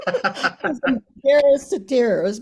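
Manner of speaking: high, loud, laughing confession; amused and mortified, drawn out. Real, not acted. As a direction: laugh through the embarrassment. Laughing over own embarrassing story.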